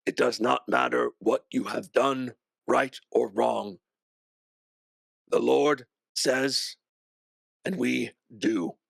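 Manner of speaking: slow, measured executioner's pronouncement; lightly voiced merciless guard; character's own voice. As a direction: pronounce it slowly, as unbending doctrine. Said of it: "You hear your voice say".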